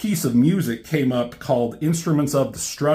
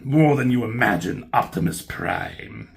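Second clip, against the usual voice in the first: menacing villain line; 5 semitones lower than usual. Retold or imitated. Reading the comic, voicing the Decepticon gloating at Optimus. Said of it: "He says there".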